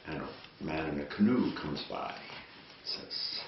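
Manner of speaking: ordinary talk, nothing marked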